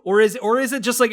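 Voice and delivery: ordinary delivery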